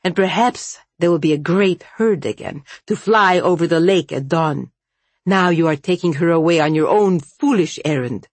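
ordinary delivery, nothing marked

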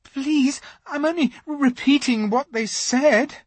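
put-on high, frightened boy's voice; a scared boy stumbling; mildly stammering Edmund. A narrator's reading. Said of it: "stammered Edmund".

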